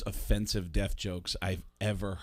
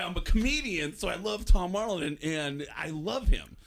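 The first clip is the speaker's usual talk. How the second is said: high, loud gushing; excited fan; 8 semitones higher, 5 dB louder than usual. Retold or imitated. Young comedian telling his friend he loves Tom Arnold, excited gushing. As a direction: gush like a starstruck fan.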